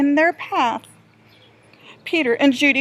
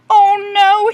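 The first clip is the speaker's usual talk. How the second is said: moaned; 6 semitones higher, 9 dB louder than usual. Acted. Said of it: "he moaned".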